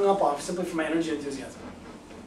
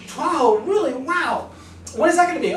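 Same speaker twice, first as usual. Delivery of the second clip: much higher, louder, thrilled; excited caller; 8 semitones higher, 4 dB louder than usual. Shown as a demonstration. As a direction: pounce on the news with delight. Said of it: "like I've just demonstrated with you right".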